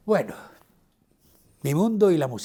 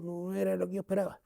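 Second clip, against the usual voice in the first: softer, lightly Andalusian "no era lo que yo esperaba" with stress on "era"; Andalusian accent; 5 semitones higher, 5 dB quieter than usual. Retold or imitated. Singer's letdown retold in a mild Andalusian accent. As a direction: repeat her words with a hint of Andalusian accent. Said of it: "Con aquel acento andaluz".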